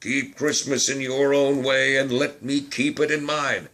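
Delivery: put-on loud, stern old voice. A narrator's reading. Scrooge sternly tells his nephew to leave him be, loud and gruff. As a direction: lay it down like a final order. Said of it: "returned the uncle sternly".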